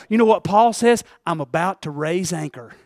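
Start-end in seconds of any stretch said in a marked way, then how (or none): 1.2-2.7 soft, slowing words of a man departing; hushed farewell, almost breathed. Acted. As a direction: let it drift away quietly. Preacher voices Paul departing softly.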